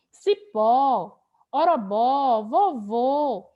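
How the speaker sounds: "Cipó", "orobó" with open final o, "vovô" with closed final o; open and closed vowels. Shown as a demonstration. Teacher contrasting abertura e fechadura, open and closed vowels. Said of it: "da abertura e da fechadura".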